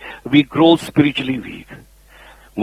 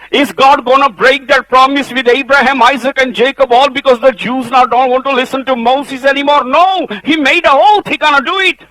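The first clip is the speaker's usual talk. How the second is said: high, loud preacher's proclamation; fervent sermon climax; preaching at full force; 11 semitones higher, 6 dB louder than usual. Acted. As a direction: drive the promise home from the pulpit.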